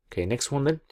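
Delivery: usual speaking voice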